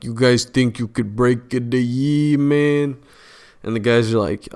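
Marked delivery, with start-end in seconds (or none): 0.0-3.0 put-on Eastern European accent. Eastern European dudes challenging the break-in crew, mock Eastern European accent. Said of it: "like, Eastern European dudes come in".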